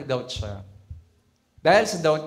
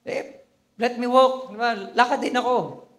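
eager Peter; 7 semitones higher, 3 dB louder than usual. Acted. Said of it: "Sabi niya".

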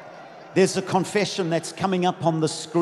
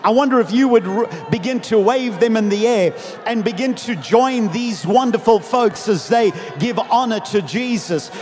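loud, rousing preacher's delivery; full-force preaching; 2 semitones higher, 5 dB louder, slower than usual. Acted. Preacher rallies the congregation on the triumphal entry, full-force preaching. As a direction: preach to a big crowd with stretched-out emphasis.

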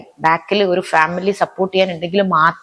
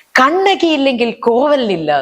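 slow, high, grieving wail with the names swapped; the slipped line, still slow and mournful; emotional stage line; 6 semitones higher, slower than usual. Retold or imitated. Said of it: "ഞാൻ പെട്ടെന്ന് പറഞ്ഞു".

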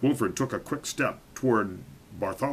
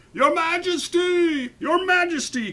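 crying out; 5 dB louder than usual. A narrator's reading. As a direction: call up the tower with all you've got. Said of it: "cried out Sir Alaric".

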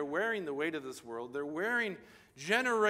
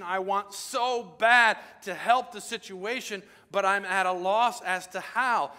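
loud, slow preaching confession; full-force preaching; 6 semitones higher, 8 dB louder than usual. Acted.

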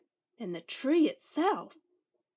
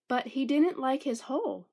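plain reading voice

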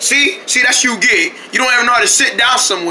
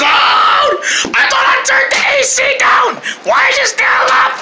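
panicked high wail. Acted. Freezing from the AC, he wails in high panic.

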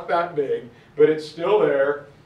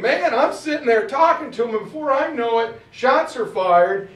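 excited protest; 7 semitones higher, 5 dB louder than usual. Retold or imitated. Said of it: "He's like".

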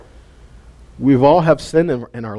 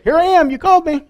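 put-on high-pitched child reporting in; Samuel; 12 semitones higher than usual. Boy back at the priest a third time, high child voice. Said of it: "this little kid".

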